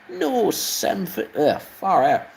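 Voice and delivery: high, slow exasperated engine voice, a second try; slow, high, fed-up engine scolding Samson; train voice attempt. Engine scolding Samson over wrong batteries, high and slow. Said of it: "Ugh, take three".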